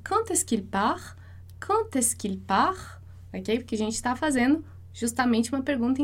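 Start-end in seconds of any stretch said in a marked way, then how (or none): 0.1-3.0 slow, rising question tune; question intonation. Shown as a demonstration. Teacher showing students the rising question tune, slow. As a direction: let "Quand est-ce qu'il part?" climb at the end like a real question. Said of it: "não esquece de fazer a entonação da pergunta".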